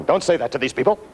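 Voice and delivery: a hint of an anxious boss hissing a warning; worried manager. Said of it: "and you're going".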